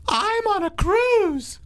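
high, slow, put-on ditzy voice; drawn-out high I'm on a cruise; cruise lady. Cohost as the cruise woman telling her friend, high slow voice. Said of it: "you're gonna pretend to be her".

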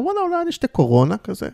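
tempted inner voice, high and light; sudden impulse. Acted. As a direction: act out a thought that just popped up, pitched high.

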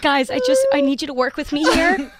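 high-pitched exasperated plea; acted-out appeal for cooperation; retold plea. Retells pleading with party guests.

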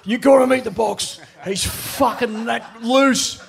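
high excited mate voice; mock excited Aussie bloke; imitating Sweaty. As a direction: hype him up like a wound-up mate. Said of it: "he was fucking sweating".

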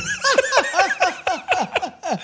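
high laughing repeat. Real, not acted. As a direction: laugh out the words in delight. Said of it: "man couple".

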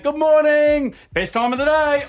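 put-on dad yelling good morning to the kids; cheery wake-up yell. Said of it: "I yell out".

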